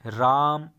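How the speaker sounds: राम called out with a long drawn आ. Shown as a demonstration. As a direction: call राम, stretching the vowel. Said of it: "जब किसी के नाम को बुलाया जाता है". (राम को लंबा खींचकर पुकारें)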